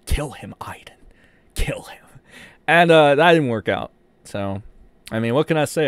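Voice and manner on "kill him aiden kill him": whispered urging; mock whisper. The imagined troll urging his mod, whispered. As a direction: hiss it like a secret order.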